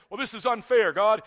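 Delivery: loud indignant protest, a touch mocking. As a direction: ham up the complaint. Mock protest Isaiah doesn't say.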